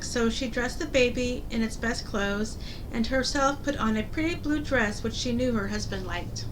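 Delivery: usual speaking voice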